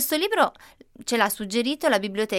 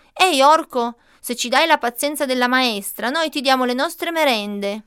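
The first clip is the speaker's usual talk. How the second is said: calling out; 3 semitones higher, 4 dB louder than usual. Acted. Omar calls into the hole.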